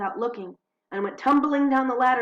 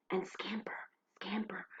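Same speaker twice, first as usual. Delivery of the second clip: whispered suspense; 11 dB quieter than usual. A narrator's reading. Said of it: "story suspense".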